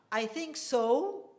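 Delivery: slow "I think so" rising on "so"; hesitant, drawing out "so" upward; doubtful. Shown as a demonstration. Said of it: "It rises".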